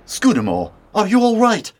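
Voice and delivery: loud, urgent check on a friend; urgent, worried shout; alarmed. Acted. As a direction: call out, pitch leaping with alarm. Friend collapses after shock.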